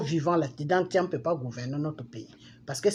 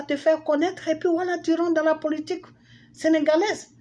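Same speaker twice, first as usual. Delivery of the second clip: high-pitched, heated rant; indignant telling-off; 9 semitones higher than usual. Real, not acted. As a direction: scold a politician, voice pitched way up and held there. Mock rant at absent politician.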